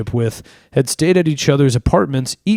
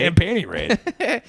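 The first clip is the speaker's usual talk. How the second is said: higher, slow put-on grumble; mock old senator; 5 semitones higher, 3 dB quieter, slower than usual. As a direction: savor it like a stuffy old man. Echoing the mock senator line.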